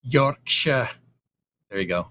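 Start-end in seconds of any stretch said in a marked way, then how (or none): 0.0-0.9 light, rough stab at a Yorkshire accent; attempted Yorkshire accent. Shown as a demonstration. Host showing off for chat, Yorkshire accent attempt. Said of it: "That sounded more like Jake Egan than Chris".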